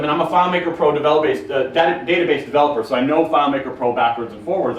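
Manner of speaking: ordinary delivery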